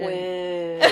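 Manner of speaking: soft, drawn-out listless chant; unenthusiastic. Retold or imitated. Co-host playing the girls, unenthusiastically. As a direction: drag it out joylessly. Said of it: "The enthusiasm is not in the room".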